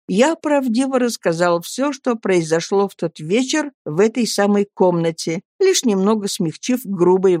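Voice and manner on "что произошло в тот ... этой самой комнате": ordinary delivery, nothing marked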